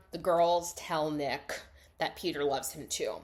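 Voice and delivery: ordinary delivery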